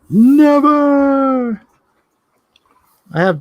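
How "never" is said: high drawn-out shout of Never; loud. Real, not acted. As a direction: belt out Never, high and long. Insisting the song never gets old.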